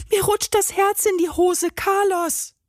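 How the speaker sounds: high, panicky cry; Benny panicking. A narrator's reading. Benny drops the heart, panicking. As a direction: squeal it in fright.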